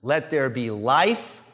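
slow spoken promise; gentle command. Shown as a demonstration. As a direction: speak life over the listener. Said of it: "When Jesus says to a person".

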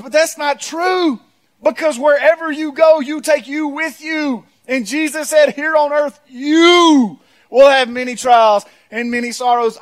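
high, slow, ringing proclamation; forceful preaching. Acted. Preacher correcting church with Jesus' words, forceful. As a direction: stretch the key words and drive them out hard.

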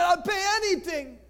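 high-pitched acted plea; urgent, pained bargaining; desperate offer. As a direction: plead with everything you have. Pastor desperately offers his wallet.

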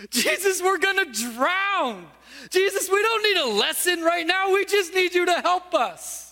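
frightened disciples protesting to Jesus mid-storm; panicked plea. Retold or imitated. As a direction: beg him urgently, voice cracking.

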